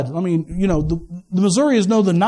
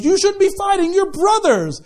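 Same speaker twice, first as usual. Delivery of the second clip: higher, preachy scold; higher pitched mock reprimand of two fighting men; Moses scolding; 8 semitones higher than usual. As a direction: step in and lecture the brothers. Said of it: "runs up on them and goes".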